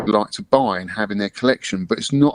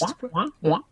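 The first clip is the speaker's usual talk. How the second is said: fish mouth blub, higher and softer; fish impression; 9 semitones higher, 6 dB quieter, much faster than usual. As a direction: bubble the words like a fish. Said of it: "that was a good fish impression".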